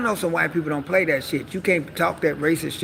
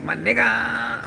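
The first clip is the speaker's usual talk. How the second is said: slow, higher stretched mock; try-hard slang; 6 semitones higher, much slower than usual. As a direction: draw it out trying to sound cool. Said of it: "try to say it in a way like".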